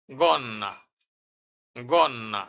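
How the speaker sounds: গন্না with a hard G and a held double n; Italian word demo. Shown as a demonstration. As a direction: model গন্না for the learners. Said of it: "উদাহরণ".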